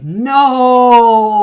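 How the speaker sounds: long, high, loud "no"; hammed-up drawn-out scream of "no"; freaked-out scream. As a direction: scream it out and hold the note. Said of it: "Kevin freaks out".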